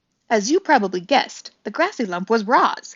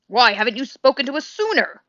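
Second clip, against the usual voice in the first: higher, louder owl screech; 4 semitones higher, 5 dB louder than usual. Acted. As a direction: screech it down from above. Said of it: "screeched the owl".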